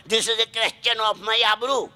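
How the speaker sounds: wife's slow, careful broken English; mock-posh wife, slow and deliberate; slow bad English. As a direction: spell it out slowly in proud broken English. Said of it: "ભયંકર English બોલે ભાઈ".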